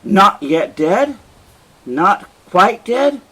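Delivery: a murderer finds his victim still breathing, incredulous. Acted. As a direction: stretch out the disbelief. Othello finds wife still alive.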